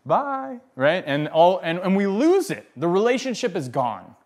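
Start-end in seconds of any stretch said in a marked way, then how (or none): 0.0-0.6 high, lilting "bye"; playful high-pitched goodbye from a plant; voicing the plant. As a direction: sing out a high farewell as the plant. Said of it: "the shitakusa is like, "Bye".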